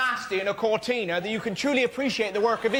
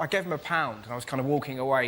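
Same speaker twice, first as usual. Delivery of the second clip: ordinary delivery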